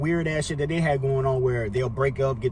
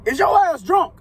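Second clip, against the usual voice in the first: high, loud retold question; acted-out incredulous challenge; 13 semitones higher, 5 dB louder than usual. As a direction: ask it loudly in pitched-up disbelief. Questions her gushing, acted quote.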